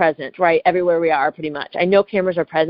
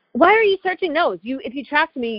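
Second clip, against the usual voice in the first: high-pitched, indignant challenge; indignant protest; 8 semitones higher than usual. Retold or imitated. Traveler challenging agent searching her snacks, indignant protest. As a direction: object sharply, voice shooting up.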